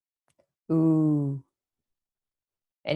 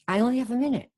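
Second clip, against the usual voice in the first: higher pitch, falling on "minute"; demonstrated intonation; 6 semitones higher, faster than usual. Shown as a demonstration. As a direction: pitch the line up, then let "minute" drop. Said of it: "And you have that intonation".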